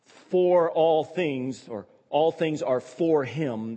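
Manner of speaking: slow, stressed for and all; slow reading, "all" and "for" pressed; emphasis on for. Shown as a demonstration. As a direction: lean hard on "for" in "for all things". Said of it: "The emphasis on".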